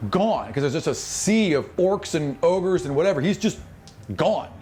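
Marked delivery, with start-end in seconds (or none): none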